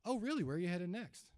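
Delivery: curious tone. Shown as a demonstration. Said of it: "I just have my process that I follow".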